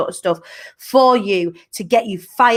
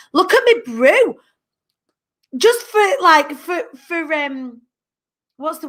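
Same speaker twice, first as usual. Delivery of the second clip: higher, slower, proud of her giant brew; delighted show-off; 6 semitones higher than usual. Real, not acted. Host shows viewers her huge mug, high and delighted. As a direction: lift it up and crow about it.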